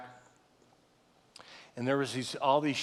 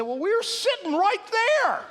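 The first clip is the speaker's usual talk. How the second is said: much higher, louder mock-excited officer; excited retold cop; 5 dB louder than usual. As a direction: play the cop's cheerful disbelief, pitched way up. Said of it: "They said".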